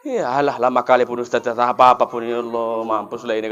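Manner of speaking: imitated restless worshipper, low undertone; impatient grumbling. Restless listener complaining privately about a long-winded preacher, impatient grumbling. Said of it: "gatal aja pantat ini, mau pulang aja".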